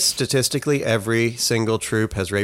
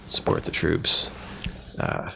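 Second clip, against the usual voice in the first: whispering; 8 semitones lower, 6 dB quieter than usual. Real, not acted. Deadpan joke punchline.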